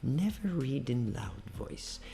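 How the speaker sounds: slow, soft repetitions sinking toward a whisper; hushed, low-pitched English drill; low voice practice. Shown as a demonstration. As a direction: rehearse quietly, drawing the words out. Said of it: "treinar em voz baixa".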